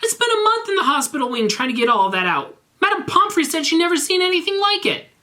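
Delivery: high, squeaky indignant rant; a whiny schoolboy pouring out his grievance; ranting Draco. Acted. Draco complains to Garfield about his hospital stay, high whiny rant.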